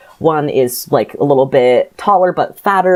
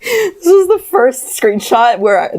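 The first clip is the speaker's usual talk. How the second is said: laughing hard, voice pitched up; 12 semitones higher than usual. Real, not acted. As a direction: laugh through the words. Bursts out laughing at the passage.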